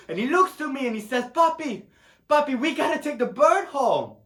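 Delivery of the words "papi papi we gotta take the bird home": put-on high, urgent child's plea to dad; high-pitched insistent pleading; pleading little son. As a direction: beg papi like an excited kid. Voices son pleading with father.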